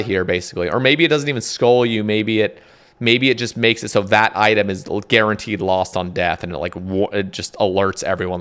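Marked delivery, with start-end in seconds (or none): none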